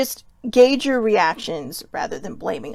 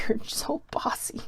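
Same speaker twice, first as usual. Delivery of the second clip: teasing whisper; 3 semitones higher, 7 dB quieter than usual. Retold or imitated. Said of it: "he's like".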